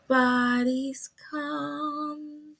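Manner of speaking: normal talking voice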